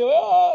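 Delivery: high, loud mock wail of a crying infant; imitating baby cry. Father retelling his son crying on Thursday, loud high mock wail. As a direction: let out a baby's wail. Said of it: "he was just crying".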